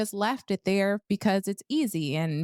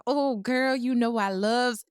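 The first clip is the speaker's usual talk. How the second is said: a light imitated Southern twang, slower; Southern twang imitation; 5 semitones higher, slower than usual. Cohost mimicking a friend's cookie talk, light Southern twang. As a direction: tease her with a Southern twang on girl and loves. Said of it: "like Southern twang".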